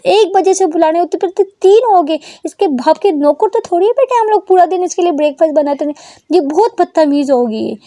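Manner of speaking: high, loud furious ranting; mother shouts about her daughter's rudeness. A narrator's reading. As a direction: rage at the rude daughter. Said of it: "वो shout करती है".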